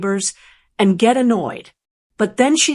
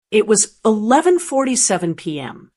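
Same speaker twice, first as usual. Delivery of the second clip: usual speaking voice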